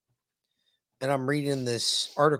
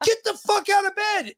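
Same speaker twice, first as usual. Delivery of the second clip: mock dad yell. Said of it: "I was like".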